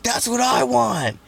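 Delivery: put-on high, slow kid's whine; high drawn-out childish want; kid whining. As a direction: whine like an eight-year-old wanting a skateboard. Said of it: "I was like".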